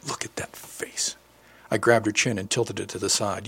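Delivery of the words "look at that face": slow, hushed whisper. A narrator's reading. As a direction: breathe it close to her ear, slowly. He whispers to her at the mirror.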